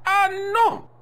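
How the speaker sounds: very high, loud cry of protest; aghast refusal. Acted. Custodian refuses to open the case, aghast. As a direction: recoil in horror at the request.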